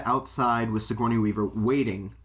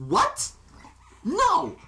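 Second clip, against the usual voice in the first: much higher and louder, mock outraged; shocked refusal; 12 semitones higher, 4 dB louder, much slower than usual. Ray refusing when she takes the money, mock shocked yelps. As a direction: yelp each no in hammed disbelief.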